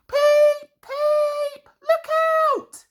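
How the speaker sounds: very high, loud cry for help; a runaway engine crying out; panicked whistle. A narrator's reading. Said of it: "he whistled".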